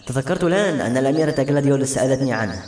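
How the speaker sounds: usual speaking voice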